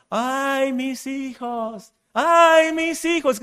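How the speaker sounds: mock ghost lament; wailing Llorona. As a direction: cry out like the weeping ghost mourning her children. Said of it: "La que gritaba".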